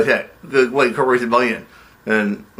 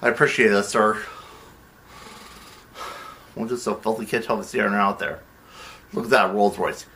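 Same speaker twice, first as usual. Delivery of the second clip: normal talking voice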